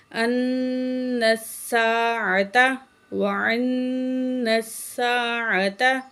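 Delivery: nasal hum held on ان; ghunna on nun. Shown as a demonstration. Teacher demonstrates the ghunna, slow recitation. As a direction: hum through the doubled nun in ان. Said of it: "نون میں غنہ کریں گے".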